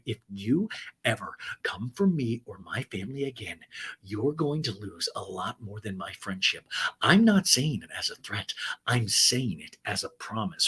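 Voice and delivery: low, measured mock threat; mock menace. Cast member warning off a friend, mock menacing. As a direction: imitate a solemn warning, weighing the words.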